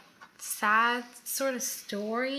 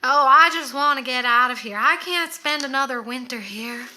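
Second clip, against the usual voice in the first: loud, dramatic put-on accent, easing toward the end; Little Edie's accent; 2 semitones higher, 8 dB louder than usual. Imitating Little Edie's complaints from the documentary, put-on accent. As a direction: imitate her accented, louder, theatrical complaint. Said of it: "That's how they talk too".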